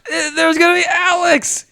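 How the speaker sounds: imitating a hyped crowd, shouting high and loud; thrilled audience. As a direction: erupt like a hyped theater crowd. The theater erupted again.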